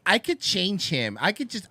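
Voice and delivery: slow, dreamy mock girl voice; hint of a lovestruck woman; mock smitten girl. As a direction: play the girl who thinks she can fix him. Imitating smitten girls.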